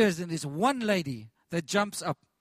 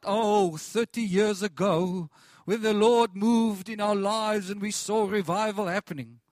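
nostalgic mock; 2 semitones higher, 7 dB louder than usual. Mocking nostalgic Christians.

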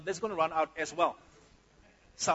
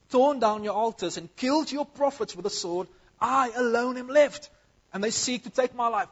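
preaching at full force; 4 semitones higher, 9 dB louder than usual. Acted. Preaching Elijah's complaint at full force.

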